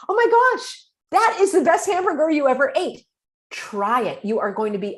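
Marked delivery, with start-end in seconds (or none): 0.1-3.1 much higher, louder enthusiastic echo; mock gushing, pitched way up; excited reflecting. Shown as a demonstration. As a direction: share their delight over the burger. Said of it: "And you say".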